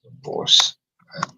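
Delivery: voiceless, spaced-out repeating to yourself; whispered to self. Real, not acted. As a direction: whisper it as you write it down. Repeating words while writing them down.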